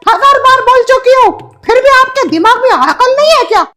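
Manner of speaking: high, loud bickering neighbour; shrill neighbour Shashi. Acted. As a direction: squawk back like an offended neighbour lady. Shashi denies angrily.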